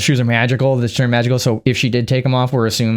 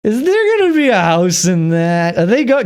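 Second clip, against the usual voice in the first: higher, slow, wide-eyed wondering; excited question; 7 semitones higher, slower than usual. Retold or imitated. Watching the tornado, a high, slow, wondering question. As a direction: gasp out the question as the tornado appears.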